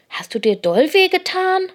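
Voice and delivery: high-pitched, concerned asking; worried question. A narrator's reading. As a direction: ask anxiously after a hurt friend. Paul rushes to comfort hurt friend.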